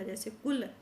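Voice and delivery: ordinary talk, nothing marked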